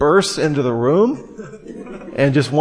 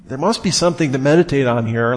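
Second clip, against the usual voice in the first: ordinary talk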